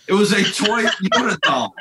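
laughing through the punchline. Real, not acted. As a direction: breaks into a laugh mid-line.

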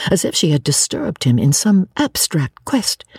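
usual speaking voice

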